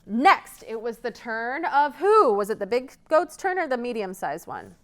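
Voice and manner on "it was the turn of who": ordinary delivery, nothing marked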